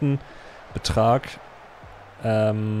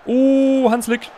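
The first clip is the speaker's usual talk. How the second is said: excited shout; 14 semitones higher, 4 dB louder, much slower than usual. Real, not acted. Near miss call.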